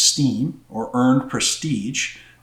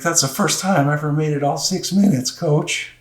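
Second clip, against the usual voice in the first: choked-up reply; 5 semitones higher, 3 dB louder than usual. Retold or imitated. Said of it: "he could barely speak".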